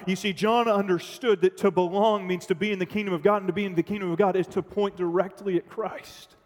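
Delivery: ordinary talk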